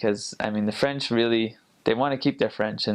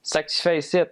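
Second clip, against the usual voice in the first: higher, run-together Quebecois accent; Quebec French; 6 semitones higher than usual. Shown as a demonstration. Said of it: "it sounds more like".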